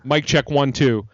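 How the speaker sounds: booming mic test into the mic; loud mic check. Acted.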